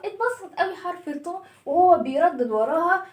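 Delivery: ordinary talk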